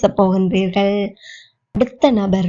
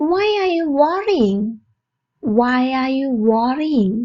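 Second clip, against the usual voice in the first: slow, evenly spaced teaching delivery; slow model phrase; much slower than usual. Shown as a demonstration. Teacher to English learners, slow model phrase. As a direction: say it slowly for learners to copy.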